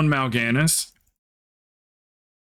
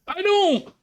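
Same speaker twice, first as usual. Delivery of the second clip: whiny reply. Retold or imitated. Voicing Crow's answer.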